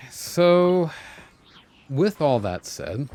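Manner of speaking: ordinary delivery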